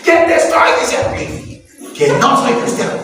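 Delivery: exasperated acted outburst, starting high; mildly hammed-up irritated denial; mock cursing denial. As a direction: snap back as an irritated Peter. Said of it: "dice que empezó a maldecir".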